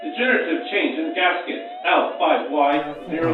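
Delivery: flat automated alarm voice over a speaker; computer announcement. Acted. Lab computer warns of failing gaskets, automated voice. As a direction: read it like a machine warning system.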